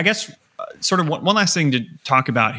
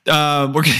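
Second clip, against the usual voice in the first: ordinary talk, nothing marked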